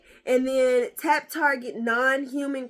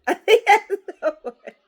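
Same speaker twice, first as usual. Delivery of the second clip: high, loud laugh through it; real laughter; 7 semitones higher, 9 dB louder than usual. Real, not acted. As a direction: giggle the line out. Laughing at own guess.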